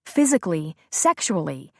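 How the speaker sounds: ordinary delivery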